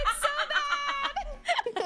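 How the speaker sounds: high, drawn-out mock jeer with laughter; mocking critics. Voice actor imitating online critics of her accent, mocking. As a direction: imitate hecklers in a stretched, high whine. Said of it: "started clowning on me".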